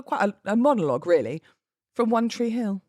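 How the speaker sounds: usual speaking voice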